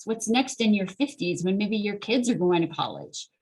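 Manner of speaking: usual speaking voice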